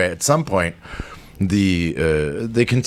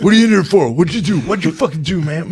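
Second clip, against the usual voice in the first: aggressive inmate; 9 semitones higher, faster than usual. Retold or imitated. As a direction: get in their face like a roid-raging con. Psycho inmate bullying the room.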